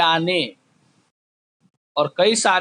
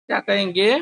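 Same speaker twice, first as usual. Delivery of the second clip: ordinary talk, nothing marked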